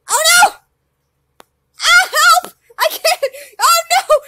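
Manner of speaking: high loud panicked yelling; panicked cries. Real, not acted. Gamer panicking as the game glitches, high yelling. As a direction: cry for help in a panic.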